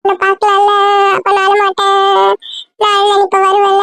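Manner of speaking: high, whining sing-song plea; tearful child. Acted. Child bargaining for a video call, whining in tears. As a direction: bargain tearfully, stretching the line endings. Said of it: "நீ அழுதுட்டே இருந்தீன்னா".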